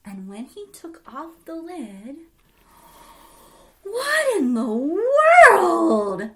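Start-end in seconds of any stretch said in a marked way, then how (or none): 3.8-6.3 slow, loud, drawn-out gasp of wonder; a stunned old man's loud cry; astonished cry. Acted. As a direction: cry out in amazement at the light. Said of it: "cried Oji-san".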